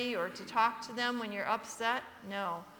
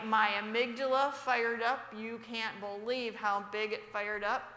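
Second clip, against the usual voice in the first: ordinary talk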